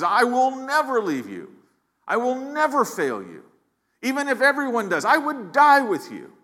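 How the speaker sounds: high, eager voice of a sure disciple; Peter's bold vow. Retold or imitated. Preacher voicing Peter's vow to Jesus, high and eager. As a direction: swear it like Peter, all confidence. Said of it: "He says".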